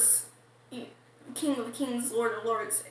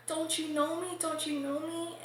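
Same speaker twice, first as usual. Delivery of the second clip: mild retold pleading; 3 semitones higher, 5 dB quieter than usual. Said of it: "going hysterical, crying".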